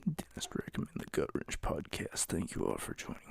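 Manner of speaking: whispered, toneless and very soft. Real, not acted. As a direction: whisper it so nobody wakes up. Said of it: "why I'm whispering".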